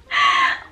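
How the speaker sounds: a shrieking burst of laughter; cracking up at a dinosaur picture; high squealing laugh. Real, not acted.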